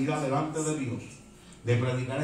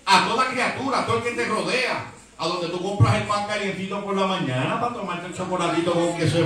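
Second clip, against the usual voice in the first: louder, higher, building sermon climax; fiery preaching; 4 semitones higher, 3 dB louder than usual. Acted. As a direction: preach it at full force to the congregation.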